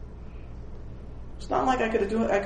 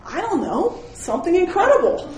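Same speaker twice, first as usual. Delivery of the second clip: a lightly voiced kid in wonder; 8 semitones higher than usual. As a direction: play the awestruck little boy. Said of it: "He goes".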